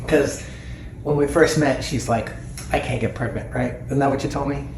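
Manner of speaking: ordinary talk, nothing marked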